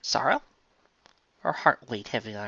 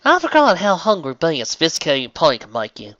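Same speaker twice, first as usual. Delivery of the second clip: louder, higher southern voice; Applejack content; 5 semitones higher, 8 dB louder than usual. Acted. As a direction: content farm pony. Applejack joking.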